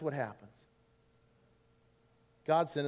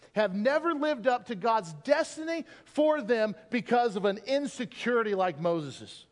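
ordinary talk, nothing marked

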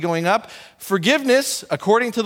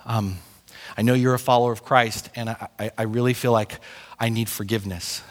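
hushed and lower, hesitant; heavy-hearted confession; 8 semitones lower, 4 dB quieter than usual. Retold or imitated. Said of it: "someone came up to you and said".

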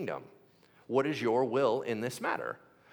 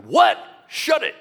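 loud, high, sharp shut-up; snapping shout; 10 semitones higher, 14 dB louder than usual. Retold or imitated. Paul shouting down the magician, loud and sharp. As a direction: cut him off with a shout.

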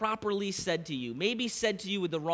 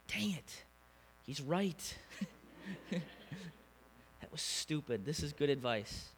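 softer, breath-only mutter with a small laugh; a rueful muttered admission, slow and soft; quiet self-talk; 9 dB quieter, much slower than usual. Retold or imitated. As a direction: concede it under your breath, alone. Alone in bedroom, conceding to himself.

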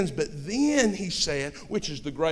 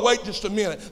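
ordinary delivery

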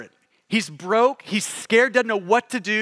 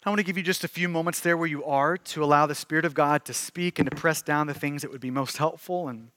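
hushed pastoral appeal; 5 semitones lower, 4 dB quieter than usual. Acted. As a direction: draw the room quietly into prayer. Soft closing and call to prayer.

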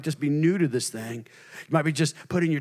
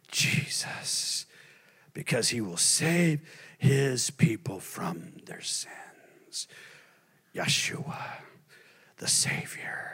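reverent whisper; 4 semitones lower, 6 dB quieter, much slower than usual. Acted. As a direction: whisper the name, slow and hushed. Angel's words read hushed.

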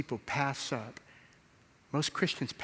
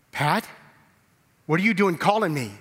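alarmed outburst; 6 semitones higher, 9 dB louder than usual. Retold or imitated. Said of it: "He goes".

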